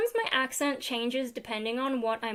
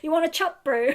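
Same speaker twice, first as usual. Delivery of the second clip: put-on New Zealand accent; 4 semitones higher, 5 dB louder than usual. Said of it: "fish and chops".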